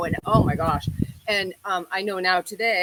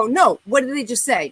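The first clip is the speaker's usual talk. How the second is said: louder, pressing demand; insistent demand; 4 semitones higher, 6 dB louder than usual. Retold or imitated. Young woman at red light demanding what the guys said, louder insistent. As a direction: push the question hard, not letting it go. She insists, ready to confront.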